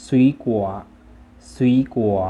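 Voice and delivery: ซุยกัว as loud, careful Chinese; Chinese pronunciation. Shown as a demonstration. Teacher models the word for fruit, Chinese pronunciation of ซุยกัว. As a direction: say ซุยกัว in Chinese, falling to the end. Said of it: "ภาษาจีนจะอ่านว่า".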